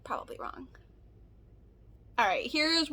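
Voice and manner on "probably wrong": soft whispered afterthought; whispered aside. Real, not acted. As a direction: whisper it to yourself.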